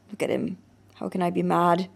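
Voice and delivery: ordinary delivery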